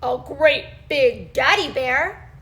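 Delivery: ordinary delivery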